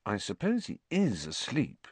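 soft, doubtful murmur; hushed and wondering; quiet. A narrator's reading. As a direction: keep it down, unsure. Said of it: "said Ron quietly".

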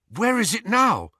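eager, high and quick; jubilant. Acted. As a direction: pounce on the good news. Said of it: "asked Harry jubilantly".

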